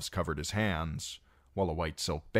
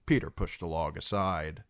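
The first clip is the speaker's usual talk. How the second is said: ordinary delivery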